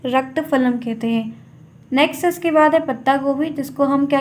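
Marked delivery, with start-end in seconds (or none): 0.1-1.5 slow down and spell out रक्त फलम, each syllable clear; slow careful repeat. Shown as a demonstration. Teacher repeating the Sanskrit word for students, slow and deliberate. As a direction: drill रक्त फलम slowly and a little softer. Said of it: "क्या कहते हैं?".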